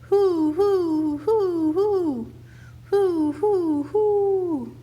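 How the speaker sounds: loud, high hooting like a great horned owl; owl call. A narrator's reading. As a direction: hoot it out into the night woods. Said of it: "and then Paul called".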